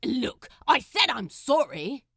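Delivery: loud, defensive snap. Acted. Crowley snapping back at the angel, loud and defensive. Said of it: "he snapped defensively".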